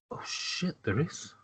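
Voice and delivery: under the breath, barely voiced; quiet, stunned mutter; hushed realization. Guard spotting the paladin through the slit, hushed realization.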